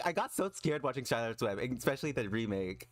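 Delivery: ordinary talk, nothing marked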